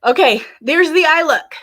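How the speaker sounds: high and loud reveal; excited. Real, not acted. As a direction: present it proudly. Reveals finished eye look.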